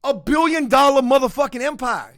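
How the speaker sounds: ordinary talk, nothing marked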